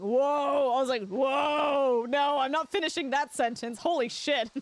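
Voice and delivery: loud startled whoa, then a rushed stop. Retold or imitated. Retelling a near slip on stream, startled. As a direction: catch yourself mid-word.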